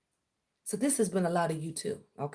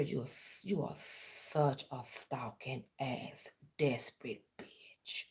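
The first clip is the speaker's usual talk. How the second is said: slow whispered insult; 6 semitones lower, 9 dB quieter, slower than usual. Real, not acted. Calling out her rival in a slow contemptuous whisper. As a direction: drop to a slow, hissed whisper.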